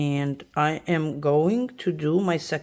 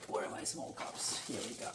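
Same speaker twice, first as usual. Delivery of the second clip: whispered to self; 5 semitones lower, 14 dB quieter than usual. Real, not acted. Muttering to herself searching.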